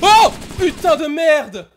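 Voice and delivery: startled shriek, high and loud; jump-scare yell; scared shout. Real, not acted. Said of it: "putain, il m'a fait peur".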